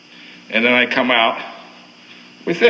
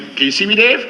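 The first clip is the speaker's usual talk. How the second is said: calling out; 7 semitones higher than usual. Real, not acted. Calling out to the cameraman.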